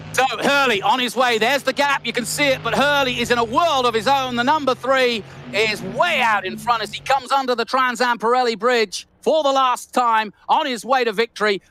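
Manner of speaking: motor-race commentator in full flow; live race commentary. Real, not acted. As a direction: call the finish with rising excitement.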